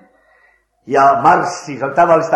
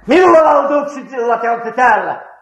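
much higher, louder barked demand; colonel's roar; 11 semitones higher, 5 dB louder than usual. Retold or imitated. Preacher retelling the colonel shouting at him, loud roar. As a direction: bellow it like an angry colonel. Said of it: "huutaa, ärjäisee".

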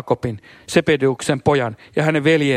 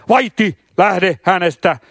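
slow, raised, stern order; stern command; 5 semitones higher, 4 dB louder, slower than usual. Acted. Jesus rebuking the unclean spirit, slow stern raised command. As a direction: spell each word out like a rebuke. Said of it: "käski henkeä ankarin sanoin".

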